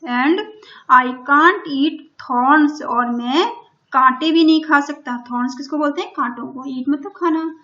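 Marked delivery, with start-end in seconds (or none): 0.0-2.8 very slow, louder, every word spaced out; slow dictation. Shown as a demonstration. Teacher reading the story line to Hindi-speaking pupils, very slow and loud. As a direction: read it word by word for the class. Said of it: "teacher reads lion's line slowly".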